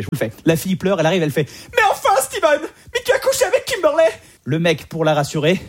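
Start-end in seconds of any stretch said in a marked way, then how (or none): 1.8-4.1 much higher, whiny soap actress; hammed-up soap heroine. Girlfriend confronting cheating Steven, high hammed-up wail. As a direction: squeal it like a wronged girlfriend.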